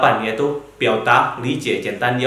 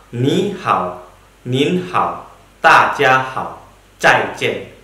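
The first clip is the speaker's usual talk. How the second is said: greetings spaced out word by word; slow Mandarin model; much slower than usual. Shown as a demonstration. Teacher models greetings for students, slow and clear. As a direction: say each greeting slowly for learners to copy. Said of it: "Contohnya berupa".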